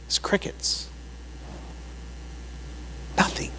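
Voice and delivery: very soft, after long pauses; hushed anticlimax. Acted. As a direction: let it drop into near silence. Deadpans the silence of Baal.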